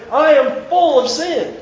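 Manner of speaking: high, loud cry of guilt; anguished cry. Acted. David confessing he is full of sin, high anguished cry. Preacher voicing David's hyperbole.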